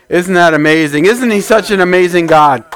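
normal talking voice

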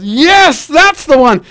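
lightly voiced husband, excited; eager husband.

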